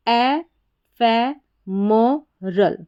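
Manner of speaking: फै म रल drawn out one by one; slow syllables. Shown as a demonstration. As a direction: stretch each syllable slowly. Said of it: "म और आर ए एल बन गया". (धीरे-धीरे अक्षर)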